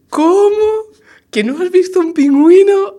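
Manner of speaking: high, loud, incredulous squeal; shocked disbelief. Acted. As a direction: gasp it out as if it can't be true. Shocked subdirector in the joke.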